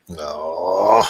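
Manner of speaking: normal talking voice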